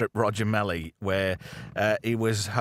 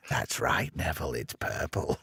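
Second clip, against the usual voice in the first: put-on low old man voice; 8 semitones lower, 4 dB quieter than usual. [name] answering in lower voice.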